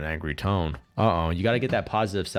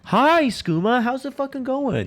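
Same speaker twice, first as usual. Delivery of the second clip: much higher cartoon voice; silly squeaky greeting; 4 dB louder, slower than usual. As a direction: greet the chat in a squeaky goof voice.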